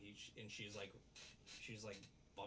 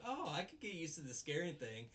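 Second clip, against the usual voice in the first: higher-pitched imitation of a pleased girl; mock delight; 6 semitones higher, 4 dB louder than usual. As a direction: say it with lightly hammed-up relish. Voices girl enjoying scaring.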